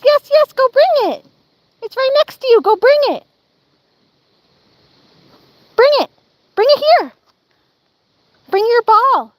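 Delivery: high, loud sing-song praise for the dog. Real, not acted. Owner coaxing her dog to fetch the ball.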